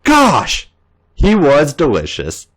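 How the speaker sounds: usual speaking voice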